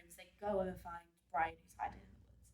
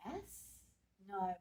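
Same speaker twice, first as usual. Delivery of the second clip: confused tone; 4 dB quieter than usual. Shown as a demonstration. Teacher demonstrates Jan's mildly confused questioning. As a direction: puzzle over it, asking then answering. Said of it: "So a confused tone would be great".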